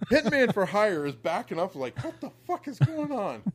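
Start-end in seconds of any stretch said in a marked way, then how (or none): 2.0-3.4 baffled. Retold or imitated. Opponent confused by mats.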